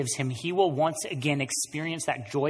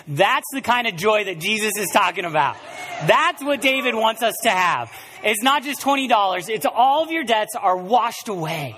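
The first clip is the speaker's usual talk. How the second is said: higher and louder, driving the point home; preaching at full force; 8 semitones higher, 7 dB louder than usual. Acted. Preacher telling the church about the joy of salvation, at full force. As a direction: lift the room with it.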